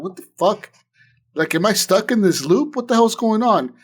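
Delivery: mock exasperated what-the-hell; retold bewilderment. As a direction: throw up your hands at the endless loop. Player confused, stuck in loop.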